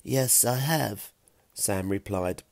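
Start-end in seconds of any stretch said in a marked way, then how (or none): 0.0-1.1 Sam's drawn-out reply. A narrator's reading. Sam answers from down the hole.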